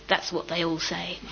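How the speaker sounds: low, distant, far-off voice of God; lightly voiced God, dry; booming God voice. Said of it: "this voice booms from the valley".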